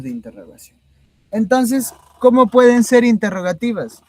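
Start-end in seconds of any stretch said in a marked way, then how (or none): 1.3-4.0 high, loud mock-student challenge; puzzled student. Teacher voicing a student who challenges the professor, high and loud puzzlement. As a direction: play a baffled pupil questioning the teacher, pitched up.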